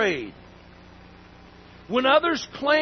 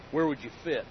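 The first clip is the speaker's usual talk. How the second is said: ordinary talk, nothing marked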